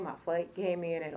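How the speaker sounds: ordinary delivery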